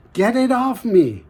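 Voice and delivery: high, loud demand; loud stone. Acted. Stone yelling at the farmer, high and loud. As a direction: shout it up high, annoyed.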